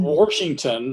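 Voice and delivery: "Washington" with an R, Southern Indiana style; Southern Indiana accent. Shown as a demonstration. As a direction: stretch out a Southern Indiana "Warshington". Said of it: "my Southern Indiana accent may come across".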